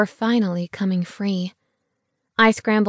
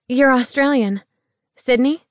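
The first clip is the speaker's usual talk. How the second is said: surprised; 5 semitones higher, 5 dB louder than usual. A narrator's reading. Startled guess at his accent.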